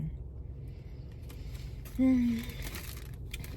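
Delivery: long breathy contented sigh; a slow relaxed exhale. Real, not acted. Sighs at the beautiful sky.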